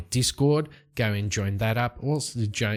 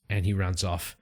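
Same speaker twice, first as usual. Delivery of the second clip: ordinary talk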